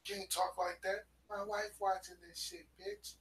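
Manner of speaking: high, smooth pimp voice imitation; smooth flashy pimp swagger. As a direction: play it like a slick pimp talking down. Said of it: "In his pimp voice".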